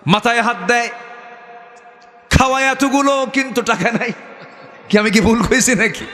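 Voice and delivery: high-pitched sermon delivery; preaching. Acted. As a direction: preach it high and insistent to the congregation. Preaches in raised voice.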